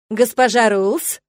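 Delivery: loud, slow, joyful call; student greets the etiquette teacher with a big smile; beaming greeting. A narrator's reading. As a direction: greet her as if overjoyed to see her. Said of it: "Широко улыбаясь и просто лучась радостью".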